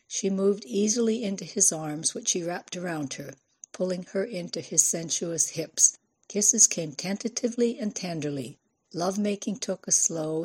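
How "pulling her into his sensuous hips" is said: ordinary delivery, nothing marked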